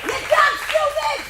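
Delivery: very high, loud shriek; piercing hammed-up screech; shrieked insult. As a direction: scream it like you've snapped. Screaming insult at driver.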